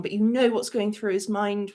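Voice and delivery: ordinary talk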